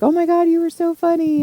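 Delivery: gushing fan voice. Retold or imitated. Mimicking gushing fans.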